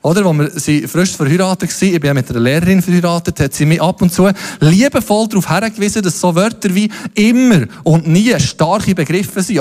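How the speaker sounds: ordinary delivery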